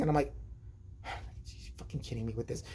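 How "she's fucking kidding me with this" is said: low, much softer muttering; muttered disbelief. Retold or imitated. Kid muttering about his oblivious mom, under breath. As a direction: grumble it to yourself in disbelief.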